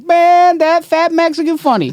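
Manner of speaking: high, loud, dismissive imitation of the host; disrespectful. Comic imitating the host announcing him dismissively. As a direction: toss it off with mock disrespect. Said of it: "He said it like that, disrespectfully".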